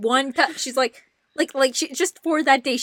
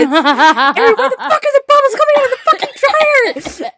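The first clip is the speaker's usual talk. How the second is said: higher, louder yell, laughing through it; laughing mock yell; 12 semitones higher, 7 dB louder than usual. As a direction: yell at a sister over the suds. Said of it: "the time she tried".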